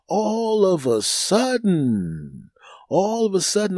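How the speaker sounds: slow, drawn-out dramatic build; dramatic buildup. Acted. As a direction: stretch it out for suspense. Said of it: "drawn-out dramatic repeat".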